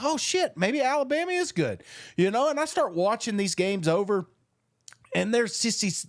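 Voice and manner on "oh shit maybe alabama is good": much higher, startled realization; mock surprise. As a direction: act out a sudden worried second thought. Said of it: "I did the narrative. I was like".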